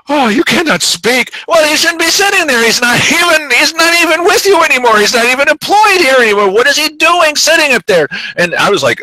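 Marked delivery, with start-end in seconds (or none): 0.1-1.3 slow, higher, prissy "you cannot speak"; mock prissy scolding. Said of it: "they were all like". 1.5-8.1 much higher, insistent public protest; indignant protest. Retold or imitated. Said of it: "he stood up and he said".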